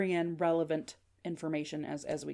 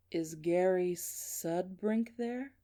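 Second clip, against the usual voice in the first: slow, drawn-out question in a measured voice; deliberate mystery caller; 3 dB quieter, much slower than usual. Acted. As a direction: stretch the words like an eerie caller.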